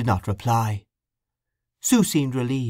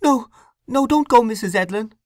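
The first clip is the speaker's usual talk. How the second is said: pleading, louder and much higher; frightened plea, raised and high; imploring; 13 semitones higher, 5 dB louder than usual. Acted. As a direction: beg her frantically not to leave. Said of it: "she implored".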